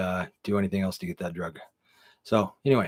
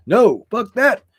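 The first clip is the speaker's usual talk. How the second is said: loud, high-pitched outburst of refusal; emphatic refusal; 12 semitones higher, 11 dB louder, much slower than usual. Acted. Storyteller rejects handing the drugs to the cops, emphatic refusal. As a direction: shoot the idea down loudly.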